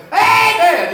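amen shouted way up high and loud. Retold or imitated. Old brother shouting in the meeting, loud high amen. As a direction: cry out amen at the top of the voice. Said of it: "he'd say".

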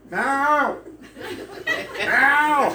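Mao shouted high and loud, with a laugh breaking in; yelling the cat's name. Retold or imitated. Foreigner in a tie calling the cat under cars, shouting. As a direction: call Mao down the street at full voice. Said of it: "So I'm yelling".

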